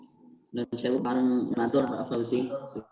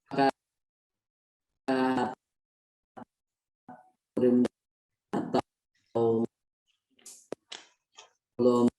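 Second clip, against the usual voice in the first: choppy call audio dropping words; audio cutting out. Real, not acted. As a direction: voice breaking up over a bad line. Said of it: "Suaranya terputus-putus ya".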